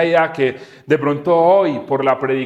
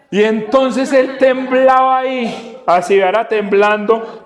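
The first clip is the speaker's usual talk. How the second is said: excited storytelling; 6 semitones higher than usual. A narrator's reading. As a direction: build the moment the boy shakes. Excited climax of the singer story.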